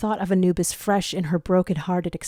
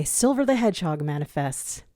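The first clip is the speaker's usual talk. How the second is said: ordinary delivery, nothing marked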